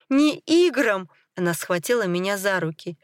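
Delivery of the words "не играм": high, loud, drawn-out correction; impassioned protest. Acted. Wife rejects his word for her schemes, protesting loudly. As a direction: cry it out slowly, voice raised.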